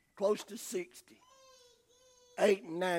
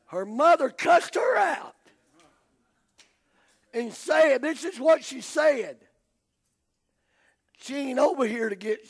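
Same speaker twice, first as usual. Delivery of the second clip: loud, raised storyteller's shout; indignant preaching; 6 semitones higher, 8 dB louder than usual. Retold or imitated. Preacher retelling how the mother cussed out the helper, loud and indignant. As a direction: belt out the outrage, high and forceful.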